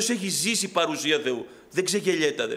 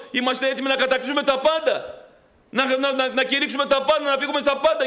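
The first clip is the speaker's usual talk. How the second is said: builds higher and louder to a fervent peak; rising preaching; 7 semitones higher, 4 dB louder than usual. Acted. Preacher mocking the rush of enthusiasm, building loud. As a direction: swell up with the crowd's rush of zeal.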